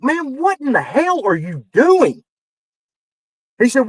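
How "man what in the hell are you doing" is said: high, drawn-out exasperated demand; mock outburst, slow and raised in pitch; retold incredulous anger. Writer confronting John over booking, retold incredulous anger.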